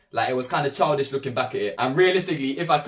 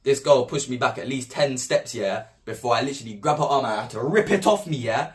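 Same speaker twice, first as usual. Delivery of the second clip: ordinary delivery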